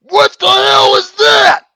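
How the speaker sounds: loud, high, slow horrified yell; shocked shout. Real, not acted. Friend reacting to the scream on screen, yelling in shock. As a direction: stretch it out in disbelief. Jump scare in scary maze.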